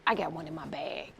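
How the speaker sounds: low, soft deadpan punchline; understated reply. Retold or imitated. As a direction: drop it low and quiet after a pause. Sheepish punchline of retold job offer.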